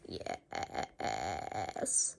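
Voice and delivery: very low, soft zombie mumble; mumbled. A narrator's reading. Hypnotized principal answering George, low mumble. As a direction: drone it, hypnotized. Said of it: "mumbled Mister Crab".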